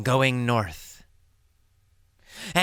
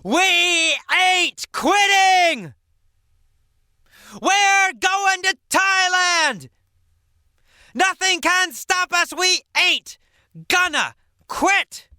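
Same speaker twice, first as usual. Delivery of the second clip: defiant shout; 13 semitones higher, 3 dB louder than usual. Acted. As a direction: shout it over the storm. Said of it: "he yelled".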